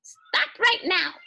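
very high, loud bird screech, slowing at the end; a mynah yelling from the papaya tree. Acted. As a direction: shriek it like a noisy bird. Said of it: "screeched the noisy mynah bird".

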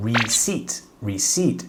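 receipt said with no p sound; receipt spoken slowly with the p left out; silent p. Shown as a demonstration. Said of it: "ตัว P มีกรันโดยไม่ได้ออกเสียง". (ออกเสียง receipt ไม่ออกเสียงตัว P)

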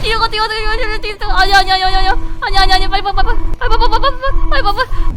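high loud frantic urging; panicked yelling. Real, not acted. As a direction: yell frantically to run. Fleeing the monster in panic.

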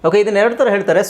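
usual speaking voice

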